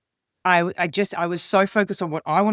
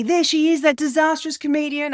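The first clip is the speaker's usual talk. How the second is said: much higher and louder, taunting and singsong; mock jeering yell; 9 semitones higher, 4 dB louder than usual. Cruise passengers jeering at the failed comedian, mock yell. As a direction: point and jeer like a gleeful passenger. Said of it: "People are yelling and pointing at me".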